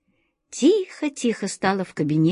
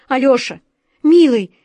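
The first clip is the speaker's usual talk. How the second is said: much higher and louder, desperate; frantic plea; 5 semitones higher, 7 dB louder than usual. A narrator's reading. The frightened director pleading with Alyosha, high and loud. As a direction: beg him with all you have.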